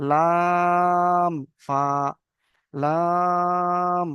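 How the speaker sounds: very slow recitation of letter names; drawn-out letter recitation. Shown as a demonstration. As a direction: stretch each letter name long and sung. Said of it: "ফা, দেখেছেন?".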